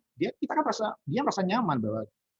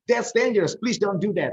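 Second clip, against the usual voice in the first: louder, higher, sharp direct command; blunt warning; 6 semitones higher, 6 dB louder than usual. Shown as a demonstration. Teacher demonstrates a blunt direct warning, loud. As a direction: bark the warning straight out.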